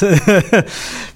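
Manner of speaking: brief amused laugh; a quick light laugh; short laugh. Real, not acted. Laughs at own dialect worry.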